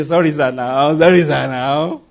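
low, drawn-out mock plea; mock begging. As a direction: imitate someone pleading with an empty apology. Mimics people begging with empty sorry.

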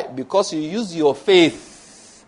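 slow, drawn-out stress through "use your faith"; emphasis on faith. Real, not acted. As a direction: stretch "faith" out slowly for emphasis. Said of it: "That's my emphasis".

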